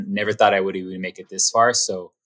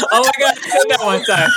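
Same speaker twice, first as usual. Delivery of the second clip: laughing; 14 semitones higher, 3 dB louder than usual. Real, not acted. Laughing at own quote.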